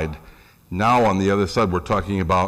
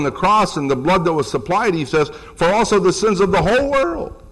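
raised pitch, pressing the point home; emphatic quote of John; 7 semitones higher than usual. Acted. Preacher voicing John emphatically.

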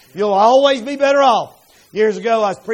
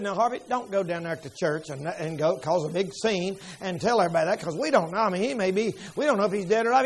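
lower, soft warning; cautious wife; 4 semitones lower, 7 dB quieter than usual. Retold or imitated. Wife warns husband not to cause a scene, softly. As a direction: gently hold him back.

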